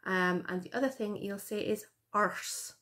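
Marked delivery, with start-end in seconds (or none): 2.1-2.7 "arse" with an American r, like "ars"; American-style arse. Retold or imitated. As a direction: mimic the narrator's rhotic "arse". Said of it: "And it's so weird".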